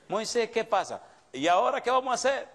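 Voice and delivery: a hammed-up heavenly voice on the line; God answering phone. God picks up Moses' call, mock exasperated.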